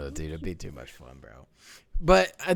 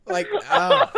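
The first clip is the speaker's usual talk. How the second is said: laughing; 4 dB louder than usual. Real, not acted. Laughing, can't help it.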